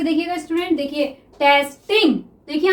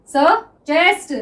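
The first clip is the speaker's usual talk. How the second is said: drawn-out word; much slower than usual. Shown as a demonstration. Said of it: "teacher sounds the word out while writing".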